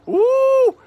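a very high, hammed-up ooh; mock thrilled ooh. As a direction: squeal at your own tiny gamble in jest. Mock thrill at boxcars bet.

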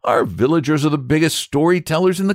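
put-on high, exasperated young boy; impatient boy. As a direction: scoff at the stories. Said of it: "said the boy impatiently".

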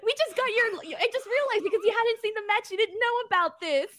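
delighted discovery; laughing realisation. Real, not acted. Host realises guest's real reaction, laughing.

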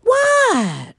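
high-pitched "what" dropping sharply; squealed pet-talk question; animal voice. Real, not acted. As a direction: call to a pet in a high, falling voice. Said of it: "That's my animal voice".

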